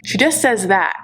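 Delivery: ordinary talk, nothing marked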